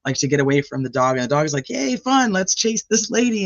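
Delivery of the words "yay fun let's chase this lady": high, gleeful dog voice; playful dog voice. Retold or imitated. Dog chasing a fleeing neighbor, high playful glee. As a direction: pipe up like an excited pup.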